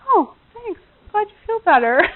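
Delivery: high, lilting mock-pleased reply; pleased thanks. Wife accepting husband's praise, high pleased thanks.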